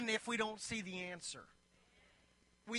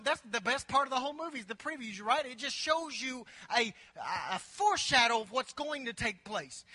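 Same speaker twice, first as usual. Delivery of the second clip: normal talking voice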